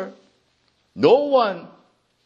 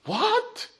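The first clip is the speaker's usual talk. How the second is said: baffled crowd; 7 semitones higher than usual. Acted. Crowd baffled by Jesus' words.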